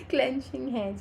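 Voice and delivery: laughing at her own slip. Real, not acted. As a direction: chuckle through the words. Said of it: "Tama ba yung pagka-pronounce ko".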